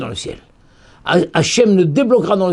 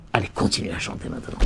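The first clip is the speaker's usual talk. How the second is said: whispered permission; 10 semitones lower, 10 dB quieter, faster than usual. Acted. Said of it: "on lui a donné la permission".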